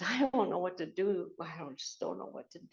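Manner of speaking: imitating a distraught woman, fading to very soft; retold helplessness, trailing away on the repeat; mock overwhelmed, losing volume and breath; breathing heavy, distraught. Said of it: "she was breathing heavy and everything".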